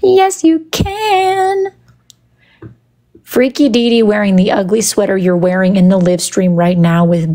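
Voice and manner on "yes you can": high, slow, stretched-out agreement; gushing yes. Pretend. Streamer granting a viewer's request, high drawn-out enthusiasm. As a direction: hold the words long and high.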